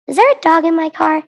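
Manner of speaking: sudden alarmed question; surprised question. Acted. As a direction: blurt it out in surprise. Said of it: "I reacted".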